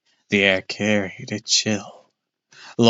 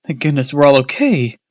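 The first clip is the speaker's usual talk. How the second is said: louder relieved exclaim; relieved cry; 5 semitones higher, 5 dB louder than usual. A narrator's reading. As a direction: gasp it out in relief. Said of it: "Mom cried".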